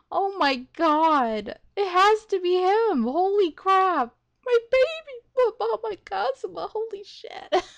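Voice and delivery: real giddy disbelief; overwhelmed. Real, not acted. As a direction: freak out with joy, voice soaring high. Overwhelmed reaction to character's return.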